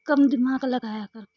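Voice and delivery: loud, firm telling-off; first take, loud and firm; guru's loud advice. Retold or imitated. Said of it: "गुरु जी कई बार तो कहते हैं".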